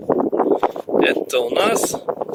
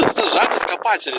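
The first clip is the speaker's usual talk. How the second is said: ordinary talk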